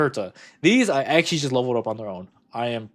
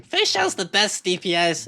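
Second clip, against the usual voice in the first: lightly mocked fan chorus, higher pitched; hyped fans imitated; 5 semitones higher than usual. Streamer quoting what everyone said, mildly hyped fan voice. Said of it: "when everyone was saying".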